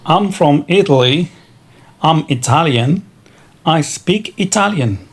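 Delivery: ordinary delivery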